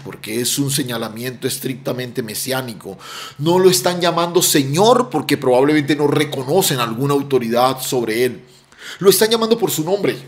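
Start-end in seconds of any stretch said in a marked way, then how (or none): none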